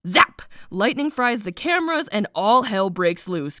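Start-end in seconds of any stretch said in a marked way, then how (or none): 0.1-0.4 loud blunt nope and zap; punchy zap. Retold or imitated. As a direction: bark it out with a zap. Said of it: "Mare's like".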